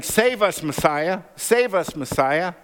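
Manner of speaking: a hammed-up hosanna cry; children crying out. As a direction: call it out like excited children. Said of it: "and the children crying out".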